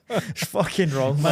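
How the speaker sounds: ordinary delivery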